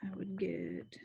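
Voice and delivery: unvoiced whisper of "I would get". Real, not acted. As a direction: whisper it to yourself. Whispered repeat.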